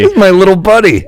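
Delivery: high, put-on cutesy introduction; mock little-buddy voice. Comic mocking the phrase little buddy, high put-on voice. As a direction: show off your little pal in a put-on voice.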